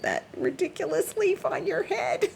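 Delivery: words breaking into real laughter; can't hold back the laugh at the leaf hat; laughing while talking. Real, not acted. Laughing at the cat.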